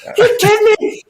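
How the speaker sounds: very high, loud mock outrage at the odds; mock angry rant. Teammate imitating the coach storming out, shrill mock fury. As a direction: ham up the furious coach's disbelief. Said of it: "It was like".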